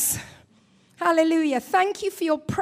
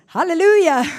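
"Hallelujah" called out full and much louder; translator's loud echo; 9 dB louder than usual. Retold or imitated. Translator echoing the voiceless preacher, loud proclamation. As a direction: boom it back like the eager translator. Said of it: "and then she's translating".

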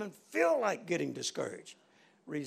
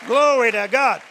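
loud, higher call to the crowd; rallying shout; 5 semitones higher, 8 dB louder than usual. Real, not acted. Preacher to the congregation, calling for a shout. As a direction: rouse the congregation.

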